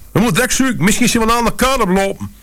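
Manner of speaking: put-on mother fearing her child is lost, panicking; panicked urging. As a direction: rush it out in alarm. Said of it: "gierde ze".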